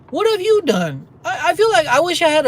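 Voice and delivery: high, loud demand at the crowd; what have you done, loud and high; exasperated outburst. Real, not acted. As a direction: throw the question at them, fed up. Ranting at passive online followers.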